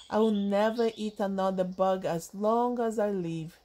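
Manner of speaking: ordinary delivery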